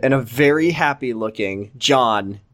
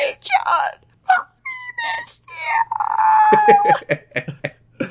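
tiny high squeak, broken by pauses; soft screechy voice. Acted. Mother greets little son on request, soft and screechy. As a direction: squeeze out a quiet, halting screech. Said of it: "Do a soft, screechy voice".

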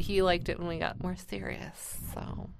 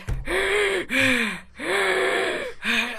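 loud, high, winded gasp; exhausted gasp; 12 semitones higher, 4 dB louder than usual. Retold or imitated. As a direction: gasp as if out of breath. Worn out after climbing stairs.

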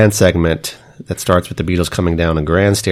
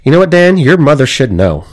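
higher and louder, mock-announcing the title; loud teasing call-out; 6 semitones higher, 5 dB louder than usual. Co-host teasingly calls out to Dan about his mother, loud. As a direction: call it out like a punchline.

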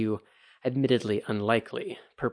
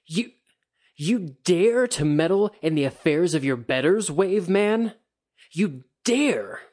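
loud, much higher sputtering fury; sputtering outrage; 7 semitones higher, 5 dB louder than usual. A narrator's reading. Kikita raging at the ronin who interfered, sputtering loud fury. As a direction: choke on the outrage. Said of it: "almost sputtered with outrage".

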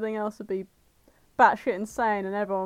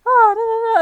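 loud shouted hard-man "Oh, no, no, no"; Vinnie Jones shout; 12 semitones higher, 11 dB louder than usual. Retold or imitated. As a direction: bellow it like a tough-guy footballer. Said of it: "I'd go".

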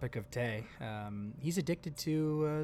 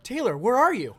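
mock blind search; 8 semitones higher, 5 dB louder than usual. Pretends to be blind without glasses.

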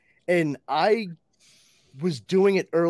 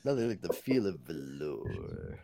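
much lower, soft, slow smug croon; pompous hero voice; 13 semitones lower, 11 dB quieter than usual. Pretend. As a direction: purr it like a vain space captain. Continuing Zap Brannigan bit.

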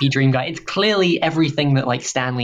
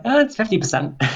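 a higher, mock voice for an overdone Irishman; mock Irish guy; 10 semitones higher than usual. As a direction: toss it off as a jokey fake Irish speaker. Mocking the fake Irish accent.

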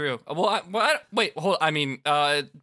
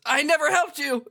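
put-on high, louder teenage-hero denial; indignant protest; 12 semitones higher, 5 dB louder than usual. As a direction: deny it hotly, pitched up. Voices Rindo protesting to villain.

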